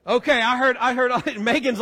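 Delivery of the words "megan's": usual speaking voice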